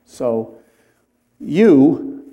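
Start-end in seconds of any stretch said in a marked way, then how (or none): 1.4-2.2 You said louder, punched; emphatic you. Shown as a demonstration. Pastor stressing God's faithfulness, emphatic You. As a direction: lean hard on the word You. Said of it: "is again emphatic".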